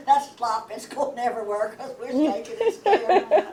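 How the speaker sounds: high, loud telling through laughter; laughing. Real, not acted. Sibling telling the family about spilling pig slop, laughing. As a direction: laugh your way through the words. Laughing at her own scary tale.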